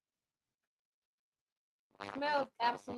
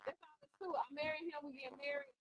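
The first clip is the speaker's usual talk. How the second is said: ordinary delivery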